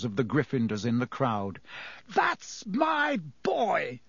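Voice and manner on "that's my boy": high, slow, drawn-out triumphant yell; jubilant yelling. A narrator's reading. Wood to Harry after the win, yelling. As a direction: stretch each word in a proud high cheer. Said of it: "Wood kept yelling".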